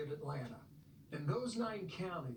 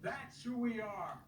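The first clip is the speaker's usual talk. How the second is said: stirring speech building to a shout; rally the crowd, end on a rousing call; 6 dB louder than usual. Acted. Rally speech continues, building to close.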